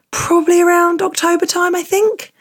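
a high, wavering maybe; unsure high lilt. Real, not acted. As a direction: voice leaps up high, uncertain. Unsure guess about return.

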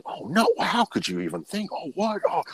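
high, flustered protest from the friend; mock defensive squeal. Friend backpedals after being caught out, high flustered squeal. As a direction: play the caught man squealing his innocence.

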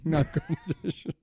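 chuckled agreement with the joke; laughing. Real, not acted. Co-host echoing the joke about podcast rankings, laughing. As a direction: echo it through laughter.

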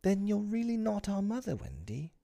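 put-on very high, small child's voice; sleepy little boy. A narrator's reading. Michael, surely sleepy, questioning Wendy, drowsy. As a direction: puzzle it out in a tiny high voice. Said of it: "who was surely sleepy".

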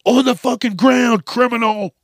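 high, loud cop bellow; yelling cop. Pretend. Cop shouting at the celebrity to get down, high loud yell. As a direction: bark it as a furious officer.

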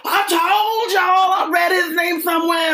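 very high, loud yell; gloating shout. Acted. Harry gloating to Ron and Hermione, full shout. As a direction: shout it like you've been vindicated. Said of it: "I don't think that, that was how he said it".

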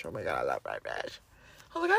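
ordinary talk, nothing marked

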